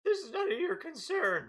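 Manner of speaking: high-pitched dismissive merchant; high cabbage merchant voice. Acted. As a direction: squeak it haughtily. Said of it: "says the cabbage man".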